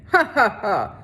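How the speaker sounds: harsh villain laugh; mocking laugh. A narrator's reading. Sheriff laughing at Catherine, mocking cackle. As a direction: laugh her down cruelly. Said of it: "He laughed again".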